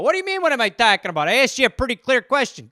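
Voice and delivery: loud, high Minnesota guy; Midwestern accent. Retold or imitated. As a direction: bellow it like a Great Lakes local. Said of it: "Midwest, represent Great Lakes".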